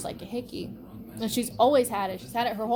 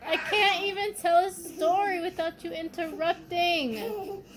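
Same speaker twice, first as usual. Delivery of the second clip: exasperated scolding; 8 semitones higher than usual. Real, not acted. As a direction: scold him, at the end of your rope. Scolding the interrupting little brother.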